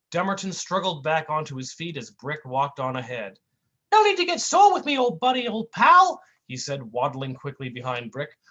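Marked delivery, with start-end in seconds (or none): 3.9-6.2 high goofy cartoon whine. Sidekick whining at his mean partner, high goofy voice. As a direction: plead like a hurt buffoon.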